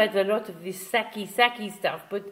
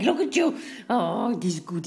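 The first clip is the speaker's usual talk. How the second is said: ordinary delivery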